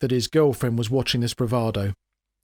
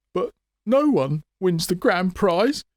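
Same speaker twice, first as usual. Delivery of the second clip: higher, slow squeak; 6 semitones higher, slower than usual. A narrator's reading. Showman protests to Grammer in a high squeak. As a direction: squeak it out in panic. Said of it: "he squeaked".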